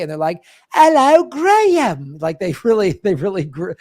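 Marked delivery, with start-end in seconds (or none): 0.7-2.2 high, loud, exaggerated British greeting; hammed-up Anglo "Graham" in two syllables; British or Australian accent. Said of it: "in Australia or the U K".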